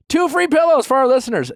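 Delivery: high excited ad read. Acted. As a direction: pitch it up with excitement.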